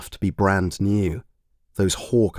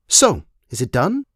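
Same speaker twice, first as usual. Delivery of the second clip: blunt, louder question; a touch too loud; 5 semitones higher, 3 dB louder than usual. A narrator's reading. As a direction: ask it loud without caring who hears. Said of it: "far too loudly for him".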